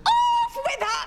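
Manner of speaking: very high shrieked command; high-pitched royal outburst; imperious shout. Acted. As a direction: shout the execution order at full pitch. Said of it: "Don't keep shouting".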